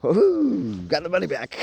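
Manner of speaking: high whooping hero cheer; excited cheer. Acted. Spider-Man cheering after getting the money back, high whoop. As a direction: whoop it out, a hero's victory.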